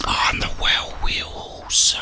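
ordinary delivery

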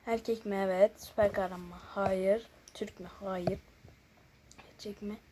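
normal talking voice

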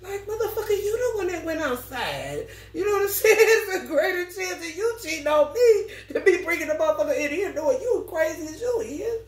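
high, laughing exasperated rant; mock rant. Talking back to a jealous boyfriend, a high laughing exasperated rant. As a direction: scold him while cracking up. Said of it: "rant at jealous man".

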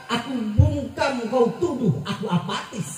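usual speaking voice

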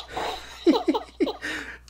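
breathy high squeaky elf giggle; elf voice changer laugh. Pretend. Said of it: "it's my elf voice changer".

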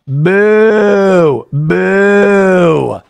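long, loud mock boos; imitated crowd booing. Everyone at the bar hearing British man, loud mock booing. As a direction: boo like a whole pub. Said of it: "everyone at the bar starts going".

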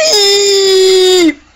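loud, drawn-out shout of the name; bellowed announcement. Pretend. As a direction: call the name out big. Naming character with a shout.

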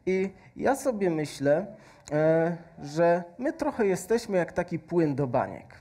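normal talking voice

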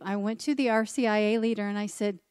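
ordinary delivery

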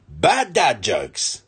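drawn-out, deadpan intro call; slow title announcement. Acted. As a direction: announce it slowly like a show title.

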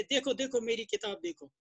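ordinary talk, nothing marked

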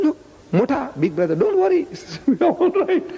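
lightly acted, high and breezy reassurance; carefree retold reply. Retold reply of the unemployed man.

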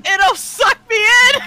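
mock wail. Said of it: "mock wail about hat".

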